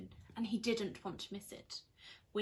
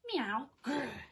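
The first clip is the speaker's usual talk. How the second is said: snarling cat; 3 semitones higher, 4 dB louder than usual. Acted. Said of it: "he snarled".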